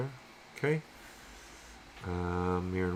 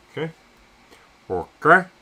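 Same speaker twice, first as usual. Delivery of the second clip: pleasantly surprised; 9 semitones higher, 15 dB louder than usual. Real, not acted. Pleased reaction to the result.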